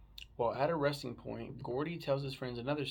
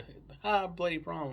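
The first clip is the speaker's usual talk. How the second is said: higher, louder "ah, bloody prom"; sudden recognition; 6 semitones higher, 5 dB louder than usual. Retold or imitated. Cousin remembering Carrie's bloody prom, sudden recognition. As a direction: act out the cousin's penny dropping.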